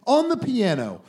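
mock earnest, slow and loud; over-sell it sarcastically; sarcastic praise. Sarcastic defence of Ethan.

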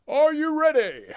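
booming, stretched-out announcer call; ring announcer. Retold or imitated. Imitating ring announcer intro.

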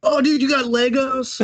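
high-pitched mock-excited gushing; mock excitement. Voices excited person naming knockoff wrongly.

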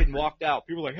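ordinary talk, nothing marked

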